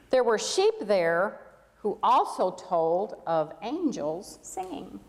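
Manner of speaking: put-on gentle storybook reading for children; story narration. A narrator's reading. Pastor reading a camel story to the congregation, storybook narration. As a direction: tell it like a bedtime tale to the whole room.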